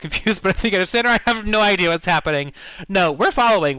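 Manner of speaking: normal talking voice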